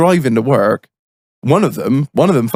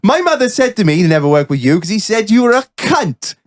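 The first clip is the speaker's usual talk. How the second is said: put-on high, lilting Welsh accent, spiteful; 8 semitones higher than usual. Welshman insulting his workmate via his mother, Welsh accent. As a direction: deliver the insult as an indignant Welshman. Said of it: "I'll do my best Welsh accent".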